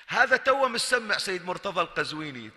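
ordinary talk

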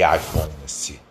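usual speaking voice

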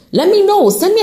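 high, loud let me know; loud call out. Real, not acted. To her listeners, offering support, a high loud call. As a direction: call it out brightly to the audience.

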